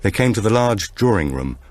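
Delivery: ordinary delivery, nothing marked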